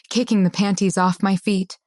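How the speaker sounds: usual speaking voice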